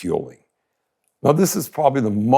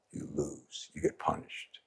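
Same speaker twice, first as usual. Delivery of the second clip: a voiceless, much softer warning; whisper; 9 semitones lower, 18 dB quieter than usual. Acted. Teacher warns listeners of punishment, hushed whisper. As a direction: breathe it out like a grim secret.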